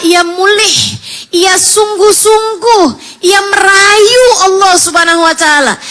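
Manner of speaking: high, loud impassioned preaching; fervent preaching. Acted. Preacher urging the women to pray sincerely, fervent preaching. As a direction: preach it with full fire.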